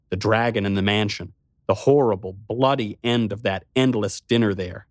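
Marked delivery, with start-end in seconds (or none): none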